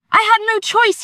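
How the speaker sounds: high, loud desperate scream. Acted. Cornered man screaming I had no choice. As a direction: Brendan screaming his defence. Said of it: "he screamed".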